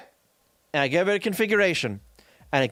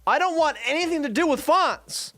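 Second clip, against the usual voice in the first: exasperated; 7 semitones higher than usual. Real, not acted. Ranting about unwanted font caching.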